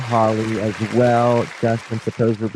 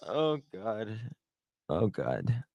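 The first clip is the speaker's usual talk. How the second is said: breathless laughter; 10 dB quieter, slower than usual. Real, not acted. Weak from laughing.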